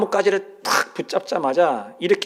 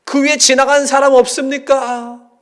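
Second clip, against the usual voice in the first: high, loud yell up the cliff; screaming for anyone passing above; yelling for help; 9 semitones higher, 7 dB louder than usual. Retold or imitated. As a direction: yell at the top of your lungs. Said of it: "목이 터져라고 외쳤습니다".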